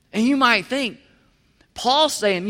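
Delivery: usual speaking voice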